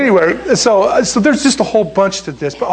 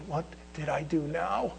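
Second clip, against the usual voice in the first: hushed, much softer dread; worried inner thought; 17 dB quieter than usual. Retold or imitated. Recruit going weak in the knees, thinking to himself, hushed. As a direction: think it to yourself, very softly. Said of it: "I'm going weak in the knees 'cause I'm thinking".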